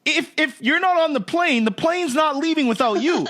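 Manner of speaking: mock-outraged, half laughing at the unfairness; exasperated inner rant. Traveler griping at flight crew skipping security, high incredulous rant. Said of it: "and you're thinking".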